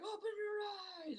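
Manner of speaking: very high, drawn-out imitation yell; held-back falsetto screaming; mock scream. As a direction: stretch out a pretend scream without much volume. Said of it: "they're screaming at him".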